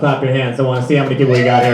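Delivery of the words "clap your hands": usual speaking voice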